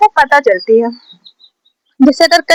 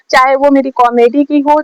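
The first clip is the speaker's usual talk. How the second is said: normal talking voice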